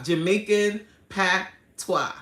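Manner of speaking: patois broken into PAT, pause, WAH; slow, separated syllables on "patois"; spelling out patois. Shown as a demonstration. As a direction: teach "patois" one beat at a time, pat... twah. Corrects the mispronounced word.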